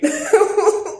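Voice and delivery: a sudden high loud laugh at herself; bitter burst of laughter. Real, not acted. Bitter laugh after he's right.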